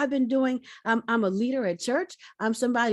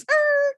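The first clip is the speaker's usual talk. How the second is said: high, loud mouth-made brake screech; 7 dB louder than usual. Retold or imitated. Her life stopping short, a loud screeching-brake noise. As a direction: imitate slamming on the brakes. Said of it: "how you slam on brakes".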